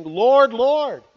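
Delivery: high, loud, begging cry; locked-out virgins pleading loudly with their Lord; loud pleading call. Acted. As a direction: call out desperately at a shut door. Foolish virgins pleading at door.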